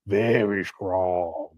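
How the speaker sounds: low, slow, menacing drawl of a dark lord; deep ominous voice. Pretend. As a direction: growl it out like a movie villain. Repeated line, deep sinister take.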